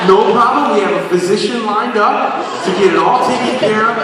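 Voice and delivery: high, bright-eyed reply that brushes off his excuse; cheerful organizer. Retold or imitated.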